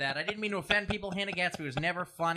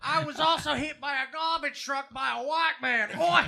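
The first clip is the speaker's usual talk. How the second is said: put-on Aussie accent; 8 semitones higher, 4 dB louder than usual. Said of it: "That's not a New Zealand accent".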